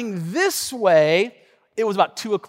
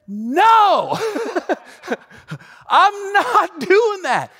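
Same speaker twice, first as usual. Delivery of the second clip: laughing refusal; 12 semitones higher, 5 dB louder, much slower than usual. Retold or imitated. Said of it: "And I said".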